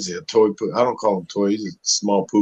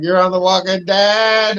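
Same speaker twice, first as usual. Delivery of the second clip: excited; 9 semitones higher than usual. Retold or imitated. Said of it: "She's like".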